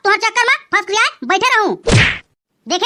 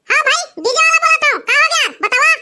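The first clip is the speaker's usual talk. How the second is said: ऊँची, बदली हुई फ़ोन वाली आवाज़ में डीजे वाला; फ़ोन पर बेफ़िक्र डीजे वाला बनकर बोलो; डीजे वाले की आवाज़; 4 semitones higher than usual. Acted. DJ operator on the phone. (दूल्हे के दोस्त से फ़ोन पर बात करता डीजे वाला, ऊँची आवाज़ में)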